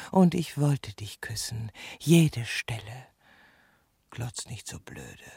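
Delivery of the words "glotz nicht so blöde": low scolding mutter. Acted. Snapping low at him.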